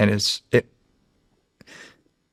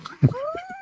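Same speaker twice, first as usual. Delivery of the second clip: high, breaking laugh of joy; overjoyed laugh; 6 dB quieter than usual. Real, not acted. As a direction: let an emotional laugh break out. Overwhelmed after casting news.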